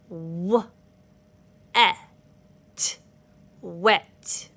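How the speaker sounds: "S, a, p, sap" sound by sound, then blended; one finger per sound. Shown as a demonstration. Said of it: "show you how to break up your words".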